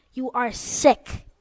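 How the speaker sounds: each word spelled out slowly; slow, emphatic scold. Real, not acted. Telling Ken watching the video, slow firm scolding. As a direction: lay it down word by word.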